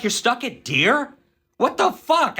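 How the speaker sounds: stunned disbelief boiling over; incredulous outburst. Retold or imitated. Incredulous rant at Pauletta.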